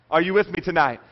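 usual speaking voice

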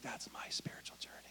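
whispered smug daydream; dreamy whisper. Retold or imitated. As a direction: whisper it as a fantasy. Dreamy self-satisfied claim.

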